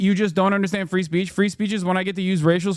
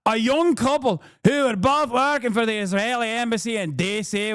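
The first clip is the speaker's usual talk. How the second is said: put-on Scottish accent; 3 semitones higher than usual. Streamer mocking a public figure's post, hammed-up Scottish accent. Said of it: "Time to bust out the Scottish accent".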